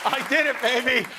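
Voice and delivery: loud, high, cheering announcement of the engagement; excited shout. Real, not acted. Host's guest bragging to the crowd, loud excited cheer. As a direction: whoop it out like a victory lap.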